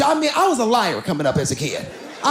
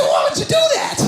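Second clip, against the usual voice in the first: shocked shout. Retold or imitated. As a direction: blurt it out in disbelief. Father shocked at daughter.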